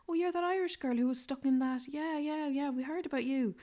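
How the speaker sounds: high-pitched excited traveller voice; imitated excited recognition. Hostel travellers recognising the Irish girl, mock excitement. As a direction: imitate strangers thrilled to recognise someone, pitched well up.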